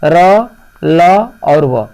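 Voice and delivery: र, ल और व spelled out one by one, very slowly; slow letter sounds. Shown as a demonstration. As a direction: sound out र, ल and व each on its own, drawn out. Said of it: "देखिए, य".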